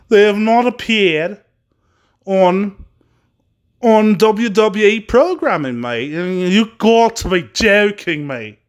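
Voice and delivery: slow, put-on Australian accent; your best attempt at an Aussie accent, drawn out; hammed-up Aussie with a drop on "mate". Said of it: "that's the best Australian accent I got".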